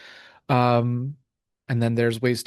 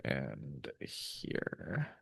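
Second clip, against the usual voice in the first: ordinary talk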